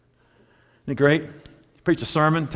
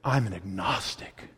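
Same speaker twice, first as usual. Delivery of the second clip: mock snooty declaration; 7 semitones lower, 9 dB quieter than usual. Shown as a demonstration. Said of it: "Say it like this".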